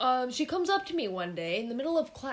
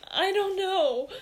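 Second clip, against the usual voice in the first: high mock whine; wailing child; 9 semitones higher, 4 dB louder, much slower than usual. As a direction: whine it like a clueless little kid. Replaying the hurt child's answer.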